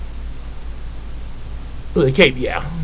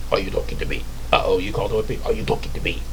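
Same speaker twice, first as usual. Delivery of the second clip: whispered build-up; 8 semitones lower, 10 dB quieter, much faster than usual. Retold or imitated. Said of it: "what Pumbaa did in The Lion King".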